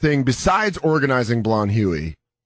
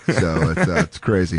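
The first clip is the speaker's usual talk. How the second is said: normal talking voice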